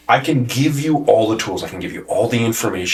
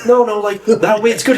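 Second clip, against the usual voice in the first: high protest; 14 semitones higher than usual. Retold or imitated. Retelling protest to trainer.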